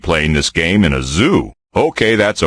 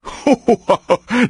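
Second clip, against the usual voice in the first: giddy bear giggle. Acted. Bear laughing with joy.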